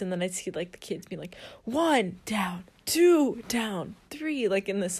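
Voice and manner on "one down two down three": lightly mimicked drill count; military count-off. Kids at lifeguard camp counting push-ups, mock military style. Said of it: "military style fashion".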